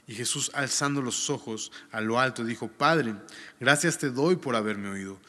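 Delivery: formal reading of the Gospel; scripture reading. Acted. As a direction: read the passage out to the congregation. Reads scripture aloud.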